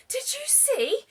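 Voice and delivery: high, breathless and thrilled; excited panting. Acted. Tired penguin landing on the whale, panting high. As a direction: gasp it out after flying. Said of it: "he panted".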